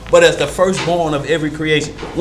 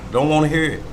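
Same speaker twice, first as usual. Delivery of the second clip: ordinary talk, nothing marked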